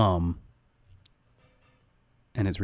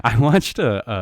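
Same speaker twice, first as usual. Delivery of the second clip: laughing; 3 semitones higher, 5 dB louder, much slower than usual. Real, not acted. Laughs while telling.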